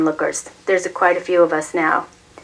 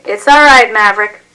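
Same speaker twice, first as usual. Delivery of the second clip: higher, louder call across the street; hollered encouragement; 4 semitones higher, 9 dB louder than usual. A narrator's reading. Onlooker hollering support to father, loud call. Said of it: "One person hollers out".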